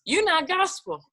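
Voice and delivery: lightly voiced industry gatekeeper; Billboard's verdict. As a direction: deliver the label's ruling like a blunt official. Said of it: "But Billboard said".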